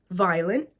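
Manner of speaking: ordinary talk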